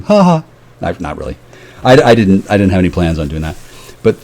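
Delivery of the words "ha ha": high, put-on "ha ha"; fake laugh. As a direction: laugh at them on purpose. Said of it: "now I'm laughing at 'em".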